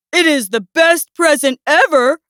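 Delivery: mildly proud bear; proud announcement. A narrator's reading. Bear presents his gift to Mouse, mild pride. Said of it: "Bear announced proudly".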